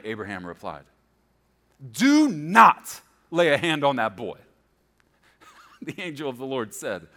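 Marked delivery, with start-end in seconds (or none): 1.9-4.4 loud, slow, weighty order; forceful command. A narrator's reading. Preacher voicing angel halting Abraham, loud slow command. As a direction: stop him with slow, loud words.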